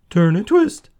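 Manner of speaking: loud, high honk; sharp high goose call. Acted. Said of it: "honked the goose".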